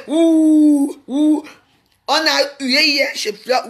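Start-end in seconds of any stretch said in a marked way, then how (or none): 0.1-1.4 long drawn-out mock boo, higher and louder; imitated crowd booing. Retelling how the crowd booed the queen mother, long loud mock boo. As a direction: stretch out a jeering "ouh" like a booing crowd. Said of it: "les gens font".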